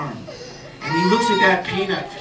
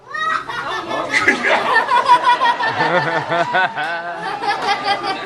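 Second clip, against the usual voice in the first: long real laugh; 14 semitones higher than usual. Real, not acted. Storyteller laughs at own tale.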